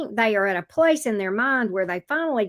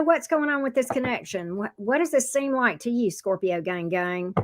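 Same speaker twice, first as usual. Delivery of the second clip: usual speaking voice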